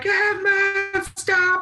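very high whiny "stop"; mock whiny pleading. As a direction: plead high like he's being shaken. Tyler having hair pulled.

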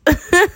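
high burst of laughter. Real, not acted. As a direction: laugh it out loud. Laughs after awkward yes.

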